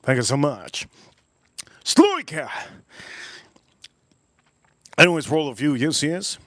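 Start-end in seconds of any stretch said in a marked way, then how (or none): 1.8-2.5 high, loud fake Russian cry; mock Russian exclamation. Answering a viewer's compliment with a mock Russian cry. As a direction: shout it like a hammed-up Russian. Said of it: "I like your Russian accent".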